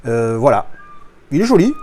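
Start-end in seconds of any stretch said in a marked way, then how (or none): 1.3-1.7 il est joli, high and loud; delighted. Real, not acted. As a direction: burst out with il est joli, thrilled. Admiring the steelbook, repeated.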